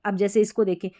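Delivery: ordinary delivery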